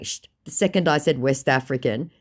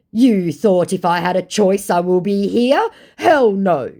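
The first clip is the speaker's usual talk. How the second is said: slow, loud, higher mock-scammer voice; scammer's retort; 6 semitones higher, 7 dB louder, slower than usual. Scammer defending himself to the woman who called him out, lightly mocked indignation. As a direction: draw out the indignant reply loudly. Acts out scammer's angry reply.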